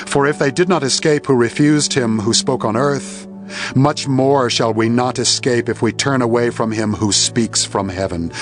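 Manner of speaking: usual speaking voice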